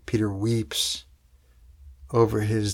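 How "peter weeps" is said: ordinary delivery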